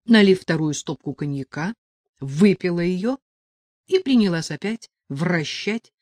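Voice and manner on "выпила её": ordinary delivery, nothing marked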